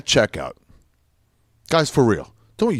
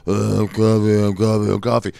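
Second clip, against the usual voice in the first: low, dragging groggy chant; crashing coffee voice; 7 semitones lower than usual. Pretend. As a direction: sag into a sleepy drone. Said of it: "all of a sudden you're like".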